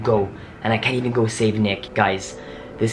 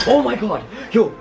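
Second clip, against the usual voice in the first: shocked; 13 semitones higher, 4 dB louder than usual. Real, not acted. Scared by a ghost reply.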